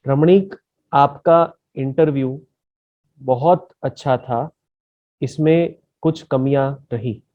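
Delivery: slowed-down, dragging delivery mimicking the candidate; imitating slow speech. Shown as a demonstration. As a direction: copy his slow pace word by word. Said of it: "मैं आपके जैसे बोलने की कोशिश करता हूं". (धीमी नकल करती आवाज़)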